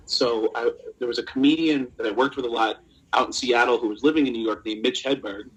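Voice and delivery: ordinary talk, nothing marked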